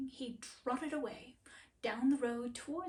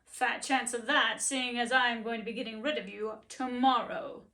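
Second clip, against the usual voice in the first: loud, mocking owner; scornful master; 7 dB louder than usual. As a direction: scoff at the silly donkey.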